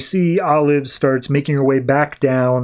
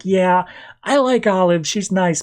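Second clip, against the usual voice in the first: put-on higher, airy gossiping girl voice; a hint of a chatty teen roommate; lightly voiced roommate; 5 semitones higher than usual. Roommate Lucy gossiping about Olive, lightly voiced higher.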